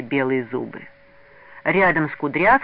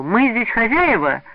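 slow, emphatic, raised voice; loud and deliberate, higher pitch; loud declaration; 6 semitones higher, 7 dB louder, slower than usual. A narrator's reading. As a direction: announce it firmly to everyone. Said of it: "громко сказал".

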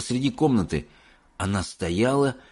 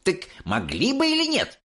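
put-on high, pushy kid's voice; insistent boy; 12 semitones higher than usual. A narrator's reading. Mike insisting to Wonka about people. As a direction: keep pressing the point.

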